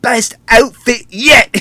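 high, slow, delighted Scottish exclamation; bad Scottish accent, high and thrilled; mock Scottish accent. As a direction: gush it in a hammed-up Scottish brogue. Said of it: "I cannot fake a Scotsman accent".

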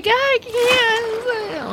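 high, whiny little wail. Retold or imitated. Julie whines back at the girls urging her, high and whiny. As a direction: whine it like a scared kid. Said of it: "Like, all whiny and everything".

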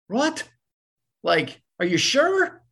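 high squeaked "What?", then drawn-out doubt; mock disbelief, slowing on the question; incredulous. Imitating startled Randy being pinch-hit for.

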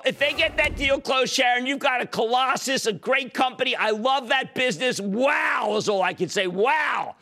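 high, hollered enthusiasm; excited gushing. Real, not acted. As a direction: rave about it like a showman. Gushes over a possible merger deal.

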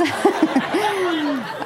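short burst of laughter; laughing. Real, not acted. Laughs at own joke.

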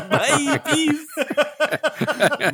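squeaky-high goodbye through laughter; mock cheery sign-off. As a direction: toss out a silly high farewell. Mock dying man's flippant farewell.

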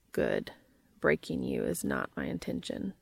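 very low, measured character voice; Cass's low voice. A narrator's reading. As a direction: drop deep and state it firmly. Narrator voices Cass, low.

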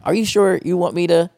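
high, hesitant, pleading question; doubtful plea. Retold or imitated. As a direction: whine it like a nervous doubter. Mimicking a doubting plea to God.

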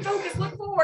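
high-pitched mock scolding; urgent passenger nagging. Partner to a driver staring at planes, mock urgent nagging. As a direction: pull the driver's attention back, playfully exasperated.

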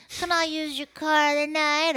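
put-on high, slow, wheedling kid voice; whiny kid. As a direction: beg like a teenager. Voices the kids asking.

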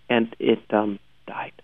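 dropped to a whisper; hushed deadpan confession. Real, not acted. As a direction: let the news die on your breath. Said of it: "deadpan whisper of show failing".

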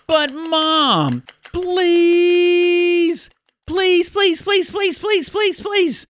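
very high, whining plea with a long drawn-out first please; begging child. Acted. Child to mom after being told no, begging. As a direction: pester mom in a squeal of rapid repeated pleases.

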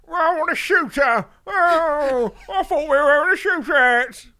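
high, loud mocking mumble; Tom Hardy mumble impression. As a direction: ham up an actor's silly film voice. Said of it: "he fucks around in every film and goes".

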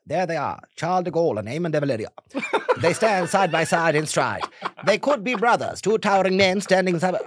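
high, loud vintage newsreel announcer; old BBC voice. Retold or imitated. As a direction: proclaim it like an old broadcast commentary. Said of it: "those old B B C voices".

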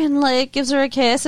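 ordinary talk

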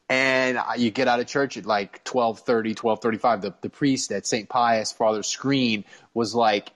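usual speaking voice

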